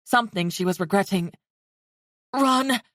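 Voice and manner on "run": hoarse urgent cry. Acted. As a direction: force it out hoarsely. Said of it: "said in a hoarse voice".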